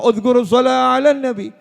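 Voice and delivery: loud, high rallying cry of a habib; sustained, high-pitched shout to the congregation; shouted salawat. Acted. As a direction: call it out high and loud across the gathering. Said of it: "ada habib yang teriak".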